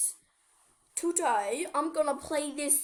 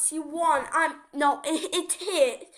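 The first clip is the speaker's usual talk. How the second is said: ordinary talk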